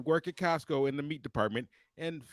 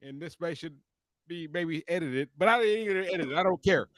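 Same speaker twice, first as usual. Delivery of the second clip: ordinary delivery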